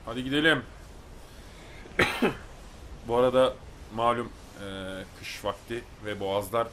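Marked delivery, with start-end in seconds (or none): 0.7-3.0 chesty cough. Real, not acted. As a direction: cough hard from a sore throat. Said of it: "arada öksüreceğim".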